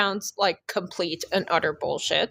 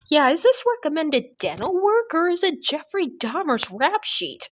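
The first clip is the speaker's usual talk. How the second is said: incredulous; 9 semitones higher than usual. Acted. Incredulous joke about dental work.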